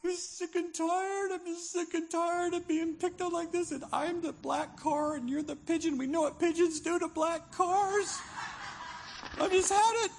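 hammed-up cry of being picked on; a fed-up victim snapping at the gulls, rising to a shout; mock fed-up whine. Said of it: "you're like".

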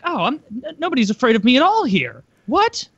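high, mock-disappointed female fighter; mock bored fighter. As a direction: pitch it up, hammed-up letdown. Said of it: "It's like".

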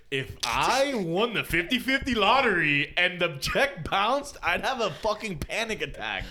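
worked up, slow and high; rising panic, stretched out; excited, high and loud. Real, not acted. Excited hypothetical rant.

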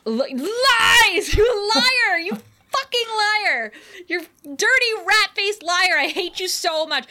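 high, loud outraged shouting; angry rant. Real, not acted. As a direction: shout wild accusations in hammed-up fury. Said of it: "when you're done ranting".

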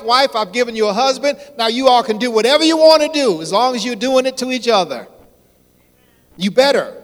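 loud, high, fast and forceful; booming command. Acted. Preacher voicing God's command to enjoy Eden, booming.

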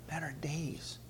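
ordinary delivery

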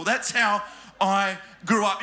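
ordinary talk, nothing marked

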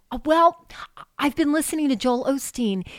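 imitating an excited friend, voice lifted; retold excitement. Friend confiding her new love of Joel Osteen, excited. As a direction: relay her gushing enthusiasm with punched words. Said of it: "I'm so excited to share this".

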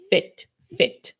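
"Fit, fit" with a short high lax i. Shown as a demonstration. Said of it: "quando alguém é fit".

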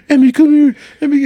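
high, loud urgent call; calling the dog. Retold or imitated. Retelling the friend calling his dog, loud urgent call. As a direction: call the dog over fast.

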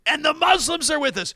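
high, projected rally proclamation; rally speaker. Retold or imitated. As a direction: imitate a speaker rousing the crowd. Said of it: "so Parker goes".

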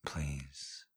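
whispering. Acted. Another whispered take.

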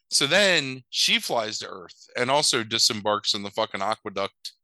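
normal talking voice

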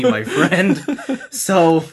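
laughing through the words. Real, not acted. Laugh heard on these lines.

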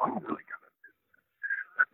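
barely voiced private thought; a hushed aside to himself; whispered thought. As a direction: breathe it out like thinking to himself. Retold inner thought, whispered.

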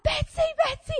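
very high, frantic retold call; panicked calling. Owner calling her dog off the sheep, panicked. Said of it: "and I'm like".

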